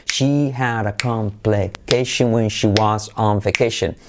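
slow model sentence. Shown as a demonstration. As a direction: draw it out evenly for learners.

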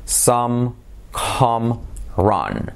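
SOME, COME, RUN one at a time with pauses; very slow, open "ah" in each word; repeat-after-me drill. Shown as a demonstration. Teacher leading repeat-after-me, slowly. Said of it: "Fíjate, repite conmigo".

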